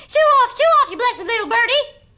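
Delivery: high, loud shout at the bird; shooing call. Acted. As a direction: shoo the bird away at full voice. Girl shoos bird off roof.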